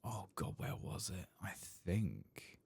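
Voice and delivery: ordinary talk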